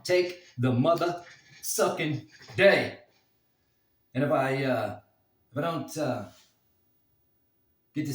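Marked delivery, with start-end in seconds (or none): 0.0-3.0 hyped shout. Acted. Catchphrase shouted for emphasis.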